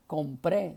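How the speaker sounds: "compré" with the stress on PRÉ; accent on final E. Shown as a demonstration. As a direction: hit the last syllable of "compré". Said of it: "En realidad no era".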